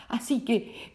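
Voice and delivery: usual speaking voice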